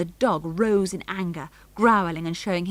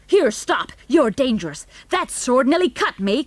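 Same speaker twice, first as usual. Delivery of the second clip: loud, high panicky cry; sudden panic; 5 semitones higher, 6 dB louder than usual. A narrator's reading. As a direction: cry out as a sword swings at you. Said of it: "cried Mister Henning in a sudden panic".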